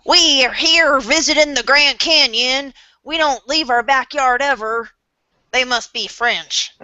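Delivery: slow, lightly put-on Southern tourist voice; a hint of a Southern accent, slow. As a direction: play a stay-at-home Southerner at the Grand Canyon, mild Southern accent. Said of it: "Just some person".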